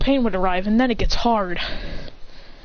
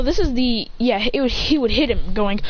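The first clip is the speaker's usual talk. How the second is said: ordinary delivery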